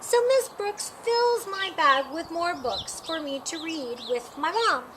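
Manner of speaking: usual speaking voice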